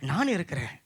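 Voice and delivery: low, soft promise from God; quiet reassurance. Acted. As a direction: say it gently and low, to comfort. God's words, first said softly. (மென்மையான, தாழ்ந்த ஆறுதல் குரல்)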